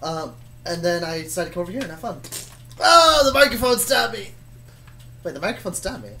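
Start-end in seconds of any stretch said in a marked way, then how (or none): none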